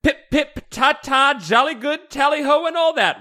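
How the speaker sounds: high, loud hammed-up British dad; mock British accent. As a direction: exclaim in a piping fake British accent. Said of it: "in his best British accent".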